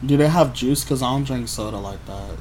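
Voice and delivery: ordinary talk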